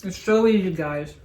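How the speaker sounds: talking through a mouthful of pie; chewing while speaking; mouth full. Real, not acted. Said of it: "I have to talk with my mouth full".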